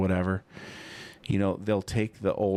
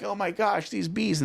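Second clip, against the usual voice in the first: surprised; 12 semitones higher than usual. Retold or imitated. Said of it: "and I'm like".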